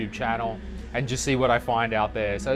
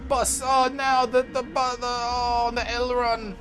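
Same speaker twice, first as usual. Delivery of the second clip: high, whiny whinging voice; hammed-up whine ending in a wailed "oh"; mock complaining; 11 semitones higher than usual. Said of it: "the shit we complain about".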